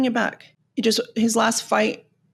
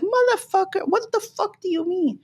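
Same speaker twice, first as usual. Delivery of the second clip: high, incredulous mock outburst; outraged inner retort; 10 semitones higher than usual. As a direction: voice the retort you held back, pitched way up. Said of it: "but I was like".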